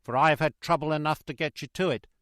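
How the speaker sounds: loud, high coachman's shout. Acted. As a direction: yell it over the horses. Coachman urging horses uphill.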